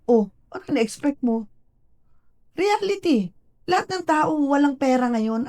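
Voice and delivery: high-pitched imitation of a chiding professor; acted-out incredulous scolding; mock exasperation. Acts out professor's reality check.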